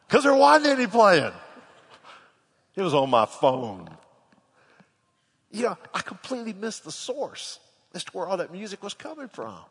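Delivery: ordinary talk, nothing marked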